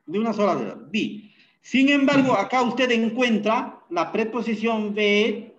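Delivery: normal talking voice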